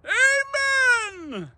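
high, far-carrying congregational holler; screamed amen. Retold or imitated. Preacher mimicking the man who shouted every Sunday, screamed amen. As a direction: imitate a fervent worshipper screaming out, vowels held long. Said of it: "He would just scream out".

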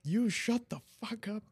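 high, slow mocking copy; mimicking the influencer. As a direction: parrot her line in a squeaky voice. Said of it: "He's just like".

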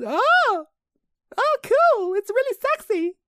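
very high falsetto mother; hammed-up squealing mum voice; impression of mum. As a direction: coo it loudly like an impressed mum. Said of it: "pretty good impression of my mum".

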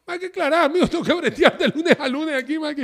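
amused exasperated plea; laughing mock plea. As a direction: playfully beg them to clear it up. Quoting himself pleading, laughing.

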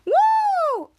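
very high, loud whoop; celebratory woo. Real, not acted. Host finishing the recap, high happy whoop. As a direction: let out a long cheering "woo".

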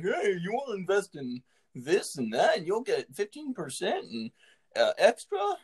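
high, swooping salesman imitation; scam guy pitch. Host mimics Instagram scammers pitching investments, high swooping hustle.

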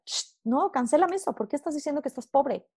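ordinary delivery

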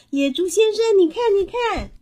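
put-on high, loud, excited child's call. A narrator's reading. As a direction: shout to a neighbour to come and look. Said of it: "他大声地说". (兴奋地高声呼喊)

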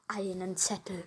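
unvoiced whisper. Real, not acted. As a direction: whisper it as an aside. Aside about the last note.

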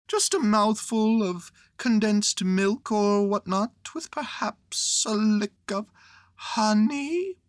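high-pitched hungry little bear; hint hopefully in a high bear voice; Pooh, hinting. Acted.

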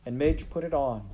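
ordinary delivery, nothing marked

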